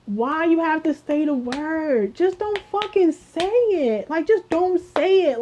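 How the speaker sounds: high, slow exasperated pleading; aggravated. Real, not acted. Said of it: "I'm getting aggravated".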